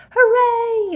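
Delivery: joyful high shout. Acted. Christopher Robin cheering as Pooh floats up, loud shout. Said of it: "you shouted".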